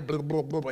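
quick mock underwater burble; imitated drowning gurgle. Preacher showing Peter's prayer drowned out, gurgling. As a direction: burble over the words as if submerged.

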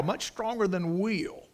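usual speaking voice

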